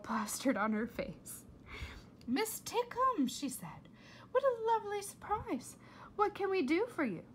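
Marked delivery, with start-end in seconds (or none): 2.3-7.2 high, sugary fake-polite voice; fake politeness. A narrator's reading. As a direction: sound sweet but false. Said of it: "big fake adult smile".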